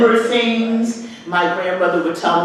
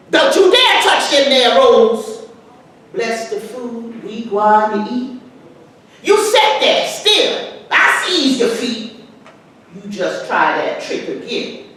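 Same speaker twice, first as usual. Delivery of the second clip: scolding mother. Acted. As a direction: catch the boy reaching for the rolls. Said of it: "recite the words to this poem".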